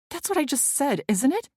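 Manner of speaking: high, sharp retort; defensive snap. A narrator's reading. Ellie snaps back defensively.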